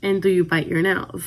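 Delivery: usual speaking voice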